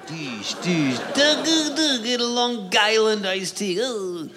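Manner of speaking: high, pumped meathead grunting; mock gym bro. Mocking the shallow-end gym guys, high meathead grunts. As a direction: ham up a lifting bro.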